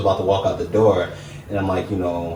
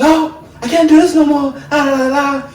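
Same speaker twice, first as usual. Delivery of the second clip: very high, loud mocking voice of an exasperated woman; mocking girlfriend snapping; 7 dB louder than usual. Man mimicking his girlfriend as she snaps, shrill mock-outburst. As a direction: squeal it like she's losing it, then sing-song la la la.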